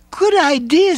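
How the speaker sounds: put-on squeaky excited girl. A narrator's reading. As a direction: jump at the idea. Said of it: "said Nancy excitedly".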